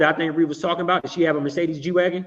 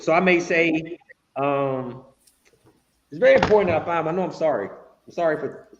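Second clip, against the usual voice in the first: ordinary delivery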